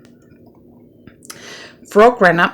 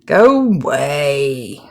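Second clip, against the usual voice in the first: grumpy Toad; much slower than usual. A narrator's reading. Grumpy Toad under covers.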